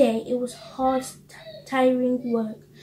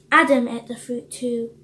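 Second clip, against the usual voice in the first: normal talking voice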